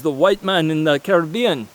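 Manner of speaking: half-hearted Caribbean accent attempt; a brief, failed stab at a Caribbean accent. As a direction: try a Caribbean accent and fumble it. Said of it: "can't do the accent".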